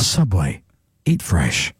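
very low, weighty ad tagline; grave brand voiceover; serious voiceover. Shown as a demonstration. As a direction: drop deep and pause between phrases. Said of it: "I can do the serious voice".

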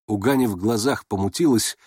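usual speaking voice

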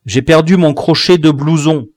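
usual speaking voice

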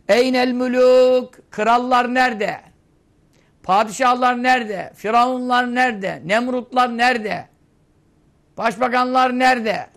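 commanding divine challenge in a sustained chant-like cry; solemn preaching proclamation. Acted. Proclaims God's questions to the dead.

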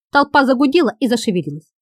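usual speaking voice

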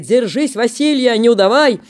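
подбадривая; loud encouragement. Acted. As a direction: egg him on from the ice. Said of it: "подбадривает".

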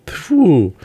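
high, drawn-out shrewish cry; quarrelsome retort. A narrator's reading. Peasant woman snapping at the friend of nations, quarrelsome. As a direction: spit it back in a high, cross voice. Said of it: "сварливо крикнула пейзанка".

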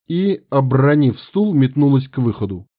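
plain reading voice

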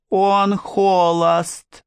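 high, slow, thrilled chorus of ladies; crying out together. A narrator's reading. Ladies repeating the news, high drawn-out excited cry. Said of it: "закричали они все разом".

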